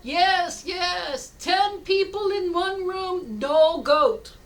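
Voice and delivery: high, loud, drawn-out delight; acted jubilation. Villager celebrating life without the goat, acted jubilation. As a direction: crow the punchline joyfully.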